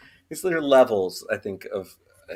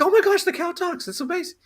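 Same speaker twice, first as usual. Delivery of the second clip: amazed. Acted. Joke, guy amazed by talking cow.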